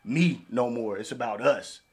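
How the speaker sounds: ordinary talk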